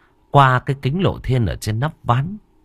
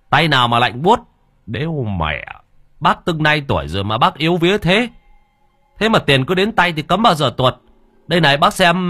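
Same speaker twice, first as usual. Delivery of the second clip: brusque scolding; 5 semitones higher than usual. A narrator's reading. Younger brother scolding his scared older brother, rising exasperation. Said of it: "ông hùng hổ".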